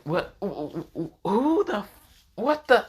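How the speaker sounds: high, rising, stammering shock; shocked sputter. Retold or imitated. Naruto shocked at marriage news.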